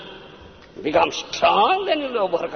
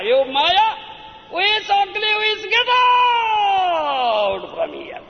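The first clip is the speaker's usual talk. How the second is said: shouting Maya away; 11 semitones higher than usual. Acted. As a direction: bellow her away, stretching the last words. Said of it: "Then he pushes back Maya".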